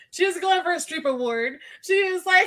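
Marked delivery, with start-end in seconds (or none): none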